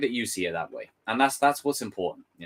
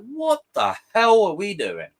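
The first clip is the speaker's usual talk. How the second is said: exasperated; 9 semitones higher, 4 dB louder than usual. Retold or imitated. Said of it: "I'm like".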